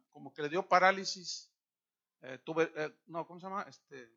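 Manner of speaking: normal talking voice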